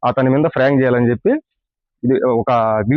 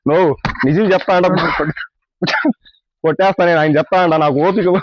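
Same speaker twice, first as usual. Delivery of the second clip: higher mock threat; pretend anger; 6 semitones higher than usual. Prankster threatens his friend for the prank, in mock anger. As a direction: act out losing patience for the prank.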